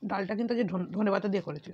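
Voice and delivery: speaking with food in mouth; mid-meal talking; talking while chewing. Real, not acted. Seen 0: Eats between bites; lips seem pursed over food while speaking.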